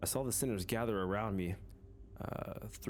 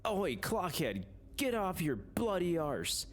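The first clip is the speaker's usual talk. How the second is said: British Sinclair; 7 semitones higher than usual. Acted. Game hero voicing a suddenly British Sinclair, British accent. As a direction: play Sinclair as a gruff British lad. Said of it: "He's British?".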